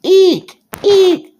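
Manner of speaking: very high, loud mouse squeak; frightened squeak. A narrator's reading. Daddy playing a frightened mouse, shrill squeaking. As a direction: squeal "eek" like a scared mouse. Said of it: "squeaked Daddy".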